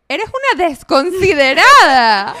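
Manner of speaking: slow, loud scolding; retold scolding. Daughter woken up scolding her mother, slow and loud. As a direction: drag out each word, telling her off. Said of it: "le dije".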